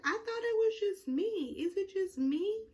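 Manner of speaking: high-pitched mock bewilderment; retold disbelief. [name] asks if it was just him, high mock disbelief.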